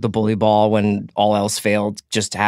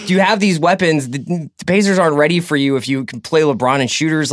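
ordinary delivery

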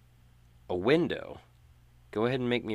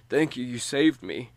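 grateful man; 4 semitones higher, 5 dB louder than usual. Acted. NPC thanks the player.

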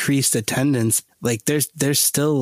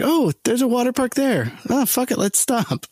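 voicing a driver, much higher; high mock tourist voice; 11 semitones higher than usual. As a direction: pitch way up as an excited traveler. Imagined Chicago drivers voiced.

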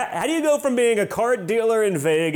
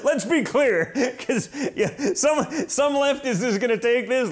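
laughing; 5 semitones higher than usual. Real, not acted. Host joking about drug dealing.

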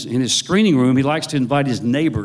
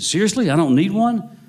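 higher-pitched, incredulous check; imitated surprised question; 7 semitones higher, 3 dB louder than usual. Actor asking the author about needing an accent, surprised disbelief. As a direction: ask as if hardly believing it.

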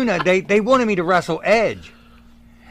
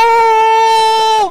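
very high, loud, sustained howl; mock pain howl; 7 dB louder than usual. Wrestler acting out yelling in opponent's face, high mock howl. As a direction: wail it out comically. Acts out screaming in her face.

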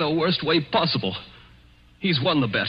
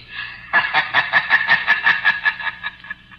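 breathy bitter laugh; 2 semitones higher, 3 dB louder than usual. Acted. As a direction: laugh in disbelief at betrayal.

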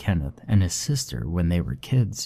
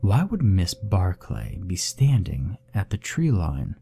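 ordinary delivery

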